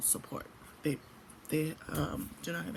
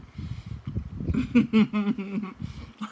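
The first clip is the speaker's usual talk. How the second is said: big burst of laughter; loud laugh; 4 semitones higher, 6 dB louder than usual. Real, not acted. Laughs at her Shrek look.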